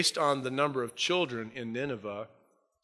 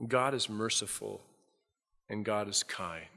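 softer, slow, weight on God; slow and sincere; 3 semitones lower, 5 dB quieter than usual. Shown as a demonstration. Preacher letting the words sink into the congregation, softly and slowly. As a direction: let each phrase land gently. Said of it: "let these words soak deep in your heart".

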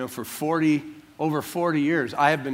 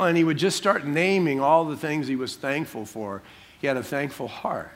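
ordinary talk, nothing marked

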